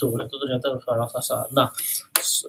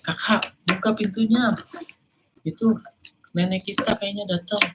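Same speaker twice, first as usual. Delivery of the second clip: put-on higher, eager child voice; little brother; 7 semitones higher than usual. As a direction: plead like a small boy. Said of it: "Kata si Jo".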